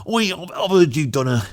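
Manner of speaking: lightly voiced Cockney bloke, quick; a hint of a Cockney geezer asking for a favour. Cockney bloke commissioning a galleon painting, mild Cockney. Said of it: "I'll do that accent".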